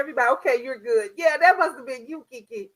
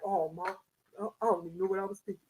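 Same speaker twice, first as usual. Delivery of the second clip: lower, softer, fast sheepish son; imitating her son; 7 semitones lower, 9 dB quieter, much faster than usual. Son apologizing to his mother for cursing. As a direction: mumble a quick sheepish excuse. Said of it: "my son be like".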